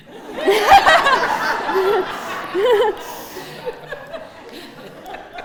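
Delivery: long loud laugh, rising high; hearty laugh. Real, not acted. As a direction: burst out laughing. Laughs at her own joke.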